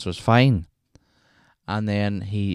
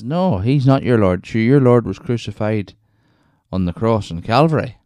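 pub man's reply; 3 semitones higher, 6 dB louder than usual. Retold or imitated. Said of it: "the man in the pub says".

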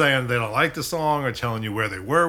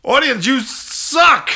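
slow, much higher, much louder decree to the crowd; booming proclamation; 13 semitones higher, 10 dB louder, much slower than usual. Pretend. Sidekick addressing the bowing masses, slow booming proclamation. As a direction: bellow it out slowly like a king's herald. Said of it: "what do you wanna tell the masses here?".

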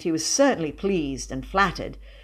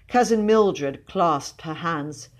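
ordinary delivery, nothing marked

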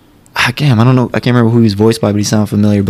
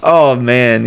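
ordinary talk

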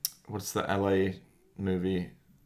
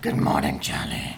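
put-on low raspy old smoker rasp; 7 semitones lower than usual. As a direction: croak it like sixty years of smoking. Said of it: "if you'd just taken up smoking and then it's sixty years later".

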